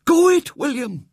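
put-on high, loud old lady egging on a fight; excited cheering. Great aunt cheering William on in the fight, high and loud. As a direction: shout encouragement at the wrestling boys.

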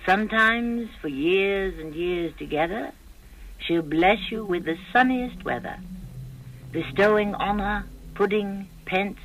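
put-on a recited old rhyme, lilting; poem recital. Host recites a verse to open the show, lilting. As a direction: recite the verse with a showman's swing.

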